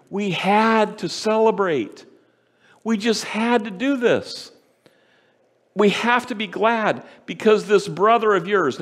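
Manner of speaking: a father coaxing his hurt son; father gently pleading with his resentful older son; earnest father. Acted.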